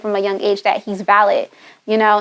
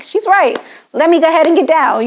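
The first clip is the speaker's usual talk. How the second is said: put-on high-pitched little-kid voice; mock kid's realisation; imitating young son; 6 semitones higher than usual. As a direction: imitate a child suddenly convinced, pitched up high. Voices her young son's realisation.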